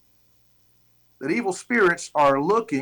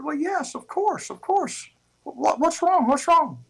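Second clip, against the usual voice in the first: baffled; 13 semitones higher than usual. Retold or imitated. Missionary baffled by chief's outburst.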